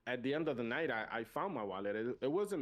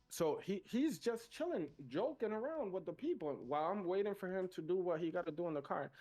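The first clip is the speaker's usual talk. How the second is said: indignant; 6 semitones higher than usual. Real, not acted. Indignant about the cop.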